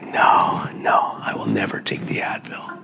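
a hint of stubborn martyr, whisper-shouted; mock hardcore refusal. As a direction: ham up a defiant vow in a harsh whisper. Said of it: "I'm like".

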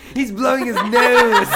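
laughing. Retold or imitated. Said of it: "Ashley is like".

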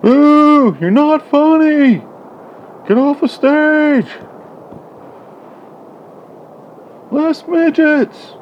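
high, loud, slow heckler yell; heckling shout. Acted. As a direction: boo them off the stage. Heckling the screen as audience.

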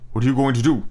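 ordinary delivery, nothing marked

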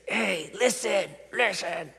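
drawn-out, high mock order; forceful demand. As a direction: demand attention, stretching the words high and slow. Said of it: "God doesn't force us".

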